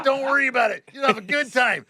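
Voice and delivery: high, loud sarcastic send-off; re-enacted gleeful jab; mock reassurance. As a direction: shout false comfort as a taunt. Taunts replacement driver while pissed.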